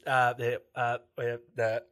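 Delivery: mock stammering, stalling on little filler words; imitated stutter. Friend caught without the notice, stuttering an excuse. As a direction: imitate a friend fumbling for an answer. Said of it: "I was kinda stuttering for a second".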